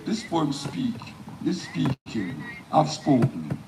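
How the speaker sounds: emphatic spoken-word delivery, phrases falling at the ends; poem recitation. Acted. Poet performing the poem live for an audience, declaimed. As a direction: declaim each line with weight, pausing between phrases.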